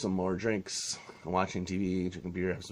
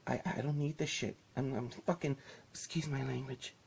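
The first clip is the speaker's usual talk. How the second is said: shaky upset receptionist; 4 semitones higher, 7 dB quieter than usual. Retold or imitated. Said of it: "And she said".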